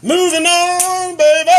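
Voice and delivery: high, loud hype transition; loud high host callout. Acted. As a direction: shout it like a host hyping the next bit.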